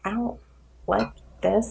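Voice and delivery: softly mumbled, uneasy reply; retold discomfort. Host retells her reaction to a voice compliment, quiet unease. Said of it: "say I had a really cute voice".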